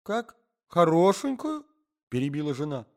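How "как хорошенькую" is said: high, indignant wife cutting in; wife interrupting her husband, high and indignant; sharp wife. A narrator's reading. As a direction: snap back, jealous and high.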